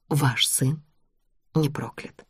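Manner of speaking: soft, deliberate, almost breathed; hushed certainty. Acted. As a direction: lay the verdict down gently. Нина торжественно утверждает королеве. (тихое торжественное утверждение)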